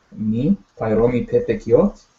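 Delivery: slow "me, pai romi pe pikiot", rising at the end on "pikiot"; question inflection. Shown as a demonstration. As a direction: ask "me, pai romi pe pikiot" slowly, lifting "pikiot". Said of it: "your voice goes up at the end".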